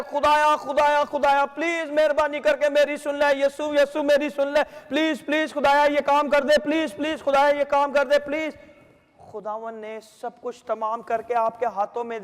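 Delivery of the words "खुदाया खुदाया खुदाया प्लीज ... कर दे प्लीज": rapid, repetitive mock begging; mimicked pleading prayer. Preacher imitating people begging God, hurried pleading. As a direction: rattle off an imploring prayer without a pause. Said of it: "बहुत से लोग दुआ करते हैं".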